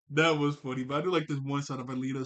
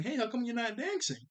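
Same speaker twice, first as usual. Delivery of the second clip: higher-pitched friendly prodding; raised-pitch playful question; lightly acted friend; 8 semitones higher than usual. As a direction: imitate coaxing someone onto the dance floor. Acting out Odd asking William.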